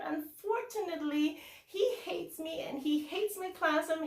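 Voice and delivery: high, bright put-on phone greeting, easing as it goes; animated pretend phone call. Teacher fakes a call to colleague down the hall, animated and high. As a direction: play up a fake chatty call. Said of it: "being the animated person that I am".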